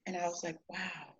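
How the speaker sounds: near-whispered, low retold wow; hushed awe. Woman retelling a voice in her dream, hushed awe. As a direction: drop to a soft whisper, as if stunned.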